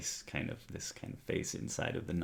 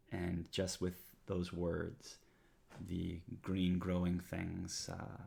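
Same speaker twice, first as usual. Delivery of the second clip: normal talking voice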